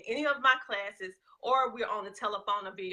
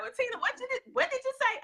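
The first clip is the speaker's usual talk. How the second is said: high puzzled voice of a confused listener; a confused caller asking to repeat, pitched up; 13 semitones higher, faster than usual. Retold or imitated. Listener asking the Southern speaker to repeat, high and puzzled. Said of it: "you be like".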